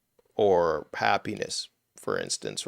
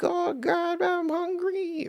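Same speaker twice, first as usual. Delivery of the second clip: much higher, slow mock wail; imitating a crying child; much slower than usual. Imitating a hungry child crying to viewers, high mock wail. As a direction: whine it up high like a hungry kid. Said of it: "They're crying, like".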